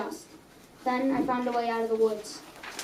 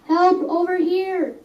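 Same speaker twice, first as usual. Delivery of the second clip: loud, higher shout; screaming for help; 6 semitones higher, 6 dB louder, slower than usual. Acted. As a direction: yell it across the field for help. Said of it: "I screamed".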